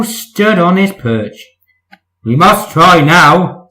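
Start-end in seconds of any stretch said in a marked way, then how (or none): 2.2-3.7 slow, louder stern rebuke; slow loud cross words. Acted. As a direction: scold him slowly. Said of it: "said the Fat Controller crossly".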